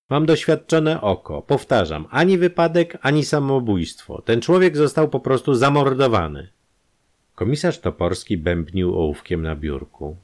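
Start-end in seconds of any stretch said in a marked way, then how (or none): 0.1-6.5 higher, louder, stressing ani twice; insistent doctor. A narrator's reading. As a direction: hammer home the verdict.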